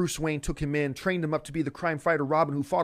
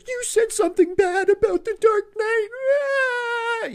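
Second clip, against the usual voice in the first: much higher and louder, slow, fake blubbering; mock crying fan; 7 dB louder, slower than usual. Imitating a fan hurt by his Dark Knight criticism, mock sobbing. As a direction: whimper it like a wounded fanboy. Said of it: "their bottom lips start to quiver".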